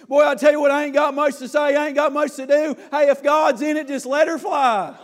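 loud, high preacher's exhortation; full-force revival sermon; fiery preaching. Acted. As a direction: preach it hard to the congregation.

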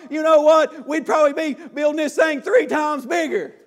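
loud, high preacher's exhortation; full-force revival sermon; fiery preaching. Acted. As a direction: preach it hard to the congregation.

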